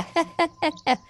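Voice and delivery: high, jeering burst of laughter; mocking laugh. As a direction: laugh at him cruelly. Bystander laughs.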